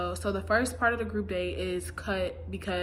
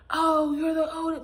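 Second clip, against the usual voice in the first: mocking sneer; 7 semitones higher, 5 dB louder than usual. Mocking quote of Dale exiting.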